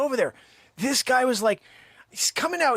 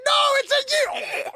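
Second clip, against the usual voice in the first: piercingly high mock shout; yelling back; 5 dB louder than usual. Customer protesting as foil flies at him, high mock yell. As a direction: imitate a loud protest that gets cut short. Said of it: "He's yelling back at me".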